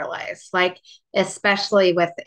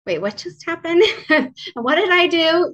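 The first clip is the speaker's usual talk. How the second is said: much higher, louder confusion breaking into a laugh; confused disbelief; 12 semitones higher, 6 dB louder than usual. Retold or imitated. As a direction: ask it bewildered, laughing at the absurdity.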